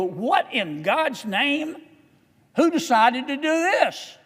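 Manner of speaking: slow, rising incredulous outcry; outraged disbelief. Retold or imitated. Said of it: "you go".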